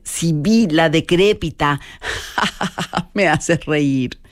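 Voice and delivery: slow words broken by a breathy laugh; laughing taunt. Acted. Susanna mocking Marchelina, laughing slowly through the taunt. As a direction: laugh at her as you insult her. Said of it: "Susana finalmente riendo".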